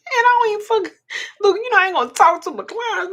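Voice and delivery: high, loud, still worked up; excited aside. Real, not acted. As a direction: still buzzing from the cheer. Excited aside before repeating cheer.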